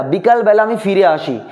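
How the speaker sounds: normal talking voice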